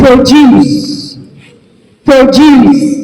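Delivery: "produce" as pro-DUCE, weight on the second syllable; verb pronunciation. Shown as a demonstration. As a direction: lean on the end of "produce". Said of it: "different ways to pronounce this".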